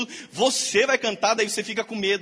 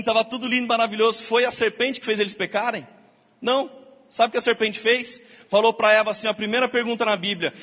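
ordinary talk

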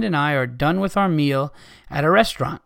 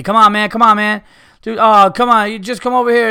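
loud, high mocking carnie call; hammed-up loud carnie come-on; pushy carnie; 5 semitones higher, 7 dB louder than usual. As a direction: bark it like a pushy carnival worker.

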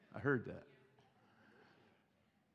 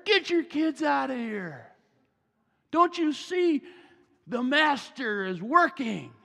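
higher, louder scolding guard; mock scolding yell; 9 semitones higher, 7 dB louder than usual. Disciples scolding parents for bothering Jesus, hammed-up yell. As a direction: shoo them off, slowing at the end. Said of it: "they yell at the parents".

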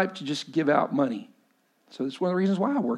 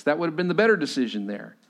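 usual speaking voice

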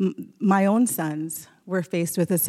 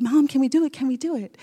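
put-on eager kid plea, pitched up; excited begging; 7 semitones higher, 5 dB louder than usual. Boys begging mom to let them trap, excited. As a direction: beg like a thrilled kid. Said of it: "they got super excited".

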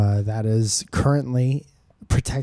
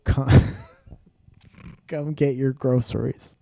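laughing quote. Retold or imitated. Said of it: "is their motto".

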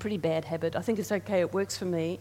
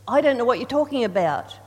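louder, brisk voice of God; 2 semitones higher, 8 dB louder, faster than usual. Retold or imitated. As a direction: answer like God cutting through. God's reply voiced.

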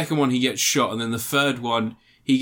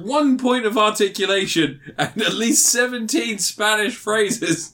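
mock praise; 9 semitones higher, 3 dB louder than usual. Real, not acted. Mock praise of bad figure.

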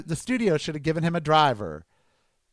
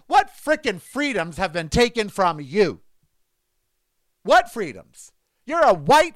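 louder, higher, indignant; mock angry rant; 5 semitones higher, 4 dB louder than usual. Calling Mister Kelly to confront him, indignant shout. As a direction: tear into him, voice rising. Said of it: "I wanna call Mister Kelly and ask him".

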